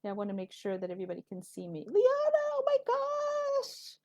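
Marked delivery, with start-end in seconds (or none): 1.9-4.0 very high, drawn-out happy squeal; overjoyed, high-pitched surprise; delighted squeal. Real, not acted. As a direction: squeal a thrilled greeting, stretching it out. Delighted greeting as viewer joins.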